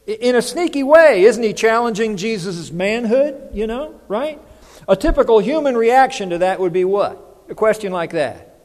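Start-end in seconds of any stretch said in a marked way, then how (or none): none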